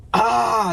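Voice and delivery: frustrated cry. Real, not acted. Traveler stuck back at work, frustrated cry. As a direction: let out a burst of frustration. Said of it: "it's so frustrating".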